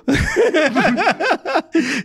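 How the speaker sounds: high bright burst of laughing; high laughter. Real, not acted. Guest laughing at his own drunken night. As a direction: laugh at your own story.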